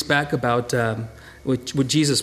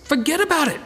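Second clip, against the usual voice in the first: put-on New Yorker accent; 10 semitones higher than usual. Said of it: "with that New Yorker accent".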